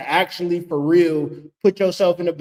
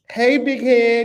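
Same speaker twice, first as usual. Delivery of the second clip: flirty call; 6 semitones higher, 4 dB louder, slower than usual. Retold or imitated. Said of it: "walk out to the goddamn social media app like".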